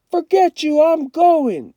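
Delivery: high, loud stubborn retort; defiant yell. A narrator's reading. Kenny answers his brother's warning, defiant shout. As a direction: snap it back defiantly.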